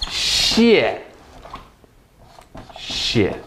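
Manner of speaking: 谢谢 as fourth tone plus neutral tone; fourth tone then neutral. Shown as a demonstration. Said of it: "This one is fourth tone. This one is neutral".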